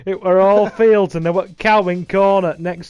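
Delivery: broad mock-northern rustic; bad faux northern. Reminiscing about fields with a friend, faux northern accent. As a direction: ham up a northern English old-timer. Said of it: "very bad faux northern accent".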